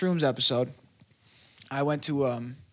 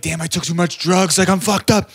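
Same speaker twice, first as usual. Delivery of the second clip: louder, rising panic; panicked thought; 3 semitones higher, 8 dB louder than usual. Retold or imitated. Tripping at producer camp, realizing he took too much, alarmed and loud. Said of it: "I started thinking".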